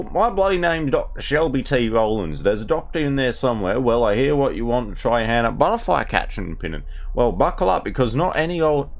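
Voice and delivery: broad ocker Australian voice, played for laughs; reading a butterfly book as a brash Australian; mock Australian accent. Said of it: "we're going with the Darrow Australian".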